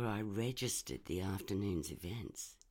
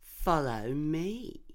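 smug man; 8 semitones higher, 6 dB louder, much slower than usual. Acted. Trevor leads proudly.

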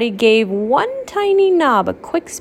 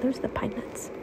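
whisper; 2 semitones higher, 14 dB quieter, faster than usual. Real, not acted. Whispering to child.

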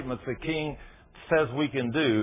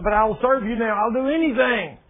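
much higher and louder frantic plea; desperate begging; 10 semitones higher, 7 dB louder than usual. Acted. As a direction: beg for mercy at the top of your voice. Damned woman begging Jesus.

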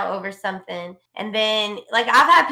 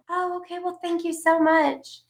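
higher, slow, sugary thanks; mock sweet caller; 5 semitones higher than usual. Ranting caller thanks agent at call's end, mock-sweet imitation. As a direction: imitate a caller suddenly turned polite.

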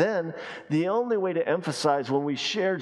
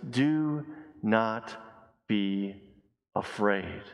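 gentle drawn-out reassurance; 5 semitones lower, 3 dB quieter, much slower than usual. Acted. Jesus to his frightened disciples, gentle reassurance. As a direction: lower and softer, with pauses between words.

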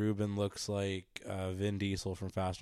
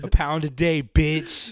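punchy; 5 semitones higher, 11 dB louder, slower than usual. Acted. Punchline tag, loud.

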